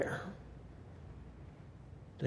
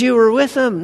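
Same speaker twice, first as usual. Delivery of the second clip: accusing; 9 semitones higher, 5 dB louder than usual. Retold or imitated. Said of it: "He says".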